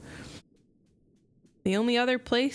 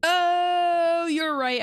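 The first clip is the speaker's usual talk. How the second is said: normal talking voice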